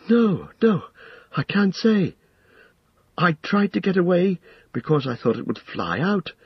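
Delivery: high, anxious hobbit voice; Pippin, frightened young hobbit. A narrator's reading. Hobbit telling the wizard what he saw, frightened.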